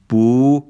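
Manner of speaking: "بو" as a drawn-out "boo"; Turkish u demo. Shown as a demonstration. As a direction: model "بو" with a long back u.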